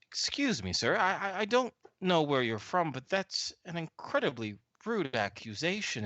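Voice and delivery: offended official stumbling over his protest; affronted tour guide. Acted. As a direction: play a fussy guide taking offence. Game master plays affronted Hugo Montoyas.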